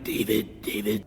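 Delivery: low, slightly hushed moan of a name; imitated moaning. Demonic voice calling the teller by name, mild imitated moan. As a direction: lightly mimic a demonic voice moaning. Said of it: "Now it's moaning my name".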